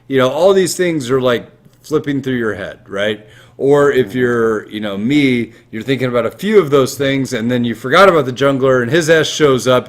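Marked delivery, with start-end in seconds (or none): none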